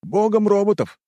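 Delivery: high-pitched voice calling out; high eager yell; shout from the crowd. A narrator's reading. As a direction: shout a suggestion over the crowd. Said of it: "выкрикнул кто-то".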